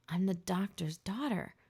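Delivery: ordinary delivery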